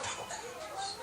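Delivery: "바로 내가 여기 있어" breathed as a whisper. Shown as a demonstration. Preacher voicing God's answer to the church, whispered. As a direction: whisper "바로 내가 여기 있어" intimately. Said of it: "Here이 붙다는 뜻은".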